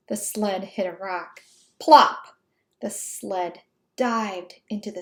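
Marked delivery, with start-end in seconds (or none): 1.8-2.4 loud bouncy plop; sound effect. A narrator's reading. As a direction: pop the word out. Sound words of crash.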